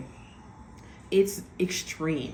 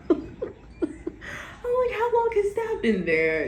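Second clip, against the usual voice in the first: high breathy giggle running into the words; giggling at a creepy discovery; giggly; much slower than usual. Real, not acted. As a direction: laugh through the question. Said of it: "'cause I'm so giggly".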